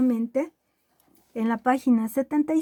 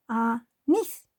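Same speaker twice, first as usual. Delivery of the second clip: anís split as a, pause, NÍS; stress on last syllable; 3 dB quieter than usual. Shown as a demonstration. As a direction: land the weight on nís in anís. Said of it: "ahí está la tónica".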